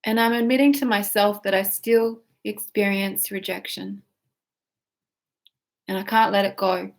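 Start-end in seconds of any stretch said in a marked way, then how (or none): none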